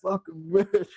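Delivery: high, put-on voice of a wounded man; mock dying curse. Quoting bitten man on the ground, mock pained curse. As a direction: imitate a movie victim cursing his attacker.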